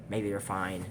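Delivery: ordinary delivery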